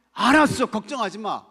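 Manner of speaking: loud, high acted answer; breezy mock reassurance. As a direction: play an instant over-eager yes, loudly. Acts Jesus answering instantly.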